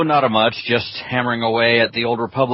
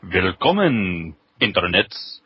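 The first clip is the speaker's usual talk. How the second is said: mock German greeting; 2 semitones higher than usual. Co-host's hammed-up German intro, in a put-on German accent. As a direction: greet the internet like a hammy German host. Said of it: "you want one, Full On?".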